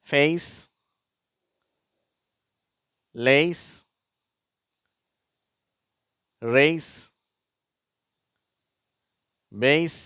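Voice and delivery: ஃபேஸ், லேஸ், ரேஸ், பேஸ், கேஸ் with an "ey" glide, high and loud; rising "ey" in ஃபேஸ், லேஸ், ரேஸ், பேஸ், கேஸ்; pronunciation demo. Shown as a demonstration. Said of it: "நான் வாசிக்கிறேன், நீங்களும் சொல்லுங்க".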